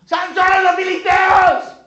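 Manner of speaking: high, full-volume warning cry; sudden piercing shout to rouse a sleeper; shouted alarm. Acted. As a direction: yell the alarm at the top of your voice. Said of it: "gritó".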